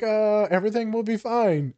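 high, bright mock comfort; put-on cheerful everything's-fine; fake reassurance. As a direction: pitch it up like soothing a client. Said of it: "Yeah, I'm like".

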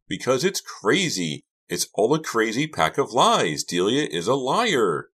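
high, loud outburst of accusation; frantic cry. A narrator's reading. Karina calls Delia a liar, frantic cry. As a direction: cry it out, hammering each claim. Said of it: "Karina cried, trembling".

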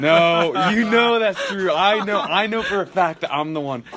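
wired and jittery on caffeine; hyped up on an energy drink; too much caffeine. Real, not acted. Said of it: "I'm wired right now".

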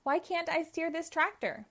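high, puzzled cry; bewildered cry. Acted. Uncle Chuck losing control of his tractor, high bewildered cry. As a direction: sound baffled and helpless. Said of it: "he cried".

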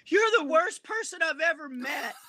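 put-on high, loud, tearful teenage outburst, shown with a laugh; girl in the tent yelling at the comedian through tears, high and loud; crying teenage girl. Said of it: "and she goes".

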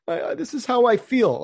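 mock sensitive player. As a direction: parody someone announcing their feelings. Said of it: "Just, you know".

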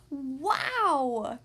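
drawn-out awed wow; amazed wow. Acted. Cubs seeing the body's inside, awed wow. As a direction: breathe it out in awe. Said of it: "the cubs said in unison".

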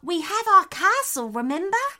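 high-pitched, encouraging animal voice; reassuring echidna. A narrator's reading. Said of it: "reassured the echidna".

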